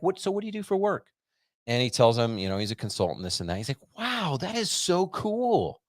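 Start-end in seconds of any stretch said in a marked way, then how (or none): 0.0-1.1 higher, eager imitated question; imitating the dermatologist asking about work, high and eager; eager doctor. 4.0-5.8 high, slow, awed mock praise; imitating the doctor being impressed, high and slow; gushing doctor.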